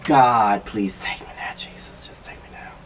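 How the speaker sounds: breathy whispered plea to God; despairing whisper. Retold or imitated. Vlogger whispering a plea to God after the guest's speech. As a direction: whisper a weary prayer to be taken. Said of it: "I'm like".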